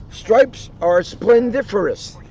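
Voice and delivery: high, slow and proud Tigger line. Acted. Tigger proudly praising his own stripes, high and slow. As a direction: savour the big word slowly.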